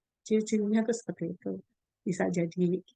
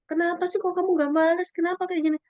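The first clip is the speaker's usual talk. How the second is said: raised, higher-pitched mock scolding of her child; angry scolding; 8 semitones higher, 3 dB louder than usual. Mother scolding her child for not fighting back, higher and louder. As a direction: snap at the kid, why didn't you fight back.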